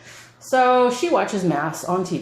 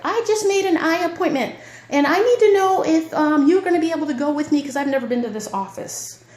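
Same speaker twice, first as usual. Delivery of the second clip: much higher imitation of mom; quoting mom; 11 semitones higher than usual. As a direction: mimic her mom's asking voice. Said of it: "And she's like".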